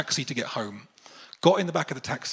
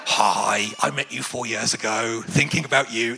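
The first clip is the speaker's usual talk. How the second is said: mock-creepy, lowered voice; super creepy; 4 semitones lower than usual. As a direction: sound like a creep texting out of the blue. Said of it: "makes me sound super creepy".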